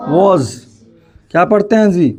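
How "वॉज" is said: वॉज with rounded "o", like "woz"; correct pronunciation. Shown as a demonstration. Teacher answering own drill question, correct pronunciation.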